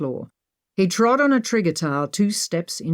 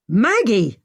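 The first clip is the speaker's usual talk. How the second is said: loud call of her name; shouting; 5 semitones higher, 5 dB louder than usual. A narrator's reading. Leo shouting after Maggie as she leaves. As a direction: call out sharply. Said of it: "Leo shouted".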